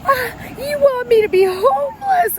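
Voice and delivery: high, loud whiny cry; loud whining accusation from the stepson; mock screaming stepson. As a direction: imitate a teen wailing in outrage. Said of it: "started screaming".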